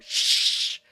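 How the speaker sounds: sharp, loud theater shush. Acted. Audience member shushes the people talking, loud shush. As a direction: shush them loudly. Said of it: "You hear someone go".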